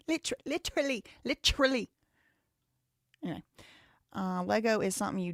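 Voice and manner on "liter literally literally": high put-on British "lit-rully"; exaggerated high British imitation; mock British accent. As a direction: practise a British accent in a raised voice. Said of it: "How they say?".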